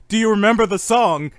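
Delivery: loud, insistent teasing; loud goading. Pretend. Host goading Dark about a song, loud and insistent. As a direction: press the question loudly.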